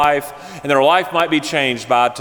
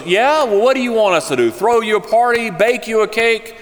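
mocking; 6 semitones higher than usual. Friend taunting the birthday woman, sarcastic and mocking. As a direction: sneer the offer like a joke.

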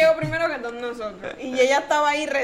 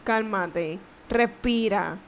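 slow, softer, calming voice; soothing; 4 semitones lower, 3 dB quieter, slower than usual. Retold or imitated. Calming mom during the quake, slow and soft. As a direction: ease a panicking mother down.

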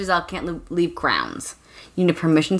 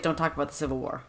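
hushed quick aside; 5 semitones lower, 9 dB quieter, much faster than usual. Retold or imitated. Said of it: "she's like".

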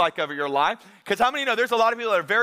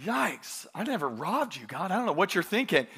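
sheepish reply; 6 dB quieter than usual. Pretend. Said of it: "And you're like".

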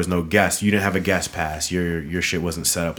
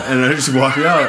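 ordinary delivery

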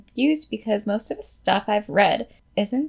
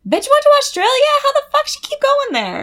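high, loud indignant squeal; mock outrage; 6 dB louder than usual. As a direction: vent shrieking to a friend. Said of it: "vent to your friends being like".

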